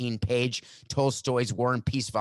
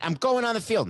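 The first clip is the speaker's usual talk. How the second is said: high-pitched defiant declaration; insist, voice jumping high; emphatic insistence; 11 semitones higher, 4 dB louder than usual. Acted. Player arguing past security to reach the field, emphatic. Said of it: "Let's see how that would go".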